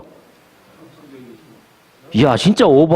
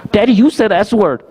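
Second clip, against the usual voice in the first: imitating a small daughter, high-pitched and slow; young daughter; 7 semitones higher, much slower than usual. Daughter correcting her dad on a bad word, imitated little-girl voice. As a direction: play a little kid pointing out a slip. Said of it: "나중에는 뭐라 그러냐면은".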